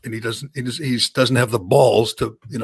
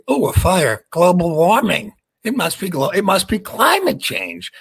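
mock liberal voice; 6 semitones higher than usual. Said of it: "you have to say".

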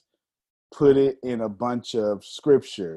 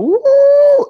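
high, loud cheering woo; imitating a loud crowd-pleasing cheer; excited whoop; 13 dB louder than usual. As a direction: whoop like a hype host. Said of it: "And she's going".

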